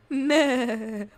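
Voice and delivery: very loud horse neigh. Acted. Streamer asked if they're supposed to be the horse, loud horse noise. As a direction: let out a big drawn-out horse noise. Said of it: "then I have to be like".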